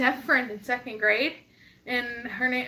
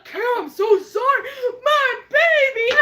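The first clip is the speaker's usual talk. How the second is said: much higher, louder, hammed-up apologetic mom; mock frantic mom; 10 semitones higher, 6 dB louder than usual. Mom apologizing for braking hard, a hammed-up frantic plea. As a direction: play the panicked mother, half laughing. Mom's apology voiced in story.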